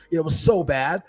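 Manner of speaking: ordinary talk, nothing marked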